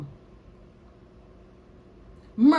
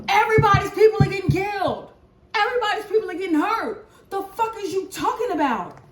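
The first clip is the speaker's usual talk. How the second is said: high, louder, fast angry ranting; heated rant; 7 semitones higher, 4 dB louder than usual. Real, not acted. Reader scolding viewers who compete over suffering, angry. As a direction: fire it at the viewers, exasperated.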